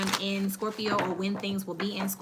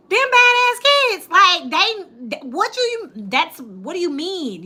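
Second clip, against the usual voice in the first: much higher, loud, slow disbelief; incredulous outburst; 9 semitones higher, 10 dB louder, slower than usual. Retold or imitated. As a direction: squeal it out in shock at the news. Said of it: "And I was like".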